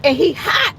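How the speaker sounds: reliving the fury at the driver; mad outburst. Retold or imitated. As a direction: snap it out in a retold fit of anger. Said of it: "I was so mad that I said".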